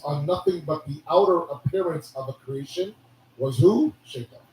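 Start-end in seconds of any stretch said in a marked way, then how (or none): none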